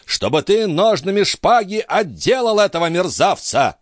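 put-on high-pitched, outraged old baron; furious high-voiced order; indignant exclamation. A narrator's reading. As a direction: demand it in a high, irate voice. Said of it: "воскликнул бледный от негодования барон".

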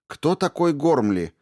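high, loud cutting-in question; abrupt demanding question; sudden interruption. A narrator's reading. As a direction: blurt it out, interrupting. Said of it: "внезапно перебила её Ариата".

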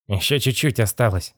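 slightly puffed character voice; puffing. A narrator's reading. Boss straining inside the sarcophagus, mildly puffing. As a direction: lightly huff through the effort. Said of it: "пропыхтел он".

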